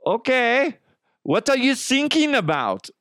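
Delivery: put-on high, oblivious German accent; German coast guard. German coast guard to sinking caller, high puzzled accent. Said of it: "this is the German Coast Guard".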